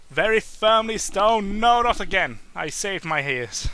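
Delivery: ordinary talk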